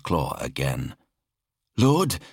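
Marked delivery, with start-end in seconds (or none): 1.8-2.2 high-pitched subordinate's report; high, strained-up servant voice; anxious officer reporting. A narrator's reading. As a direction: report to a feared master, voice pitched right up. Officer addresses the Exalted.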